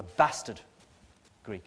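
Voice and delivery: a Northwestern accent slipping out on "bastard". Real, not acted. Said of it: "So that's my Northwestern accent coming out".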